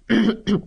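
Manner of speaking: self-important throat clearing. A narrator's reading. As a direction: clear your throat like a professor. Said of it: "Stuart cleared his throat".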